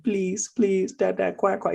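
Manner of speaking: high, pleading child impression; begging toddler. Son begs dad for crackers, pleading high toddler voice. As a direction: imitate a toddler begging desperately. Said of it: "has a fit and he's crying".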